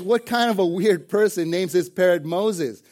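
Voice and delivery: acted, incredulous irritation; angry. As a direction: fire it off like an exasperated thief. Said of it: "He's angry, and he says".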